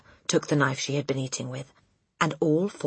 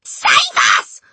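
very high, loud shriek of terror; scream; 10 dB louder than usual. A narrator's reading. Mother clings to husband, screaming in terror. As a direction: scream for rescue. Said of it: "the mother screamed".

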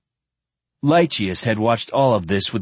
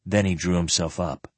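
usual speaking voice

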